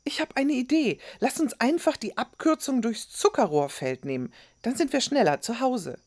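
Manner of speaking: put-on high, excited boy with an idea; eager suggestion. A narrator's reading. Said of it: "fügte er schnell hinzu". (eifrige, hohe Jungenstimme)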